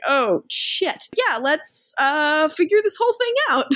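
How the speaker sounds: Damon rising into a high, fast squeak; mock Damon scrambling, voice jumping up. Recapping Damon's panicked reply to the sheriff, mock high squeak. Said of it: "And Damon's like".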